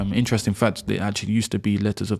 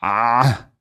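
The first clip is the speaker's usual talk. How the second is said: usual speaking voice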